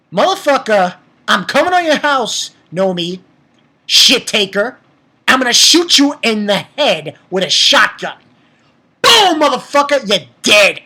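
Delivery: loud furious rant at a cartoon villain; heated shouting threats; angry yelling. Real, not acted. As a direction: yell threats at the screen. Ranting threats at anime villain.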